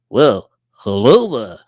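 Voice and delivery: slow, loud impression greeting; Rob. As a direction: boom it slowly into the microphone. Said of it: "you can go".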